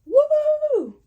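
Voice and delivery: high, loud cheerful whoop; excited whoop. Real, not acted. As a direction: shout it out to hype the intro. Excited channel intro shout.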